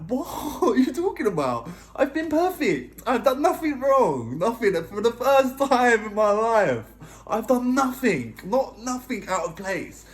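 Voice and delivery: high, loud, drawn-out indignation; disbelieving protest. Retold or imitated. Boyfriend insisting he has been perfect, disbelieving protest. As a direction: plead your innocence, stretching the words in disbelief. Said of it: "I couldn't believe it".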